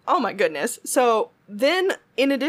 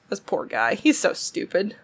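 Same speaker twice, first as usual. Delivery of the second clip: normal talking voice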